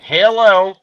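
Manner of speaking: high, loud hillbilly hello; hammed-up hillbilly phone greeting. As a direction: play a dim American hillbilly. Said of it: "I played the regular American hillbilly".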